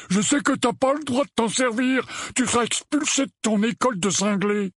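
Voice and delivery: very high, agitated loutish warning; alarmed Dudley. Acted. Dudley warns cousin off magic. (mise en garde affolée, très aiguë)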